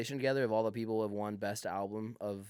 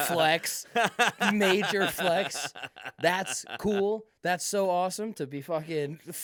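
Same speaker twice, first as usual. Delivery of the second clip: sarcastic mock praise; 4 semitones higher, 4 dB louder than usual. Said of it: "I'm like".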